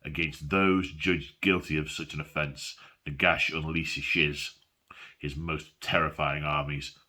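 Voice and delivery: low, dramatic reading voice; story narration. Acted. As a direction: read it like a grim legend. Reads book vignette aloud.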